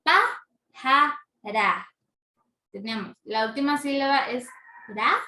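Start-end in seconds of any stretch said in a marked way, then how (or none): 0.0-1.9 "Pájara" split slowly into PA, JA, RA; teacher sounding out "Pá-ja-ra" with clear gaps; syllable by syllable. Shown as a demonstration. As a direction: break "Pájara" into three separate, emphatic syllables. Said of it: "Tenemos lo que es tres sílabas".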